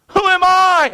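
high-pitched mock protest; resisting. Moses resisting God's call, high protest. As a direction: push back in a high acted voice. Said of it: "So he's resisting".

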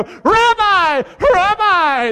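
high, loud mock-adoring call; fawning greeting. Townsfolk hailing the Pharisees with mock adoration. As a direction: call out like an eager townsman flattering a rabbi.

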